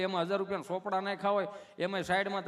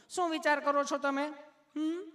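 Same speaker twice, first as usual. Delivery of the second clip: high put-on nurse voice; sweetly asking nurse; 8 semitones higher than usual. Nurse asking why he is nervous, high mock sweetness. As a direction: coo questions up high at the patient. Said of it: "તમે કેટલું પ્રેમથી પૂછો છો".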